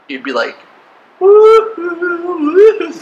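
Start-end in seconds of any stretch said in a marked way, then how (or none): 1.2-2.9 high, loud "woo-hoo"; mock crazy whoop. Classmate reacts to someone claiming heaven, mocking high whoop. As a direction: hoot as if someone is cuckoo.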